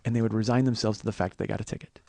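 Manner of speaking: usual speaking voice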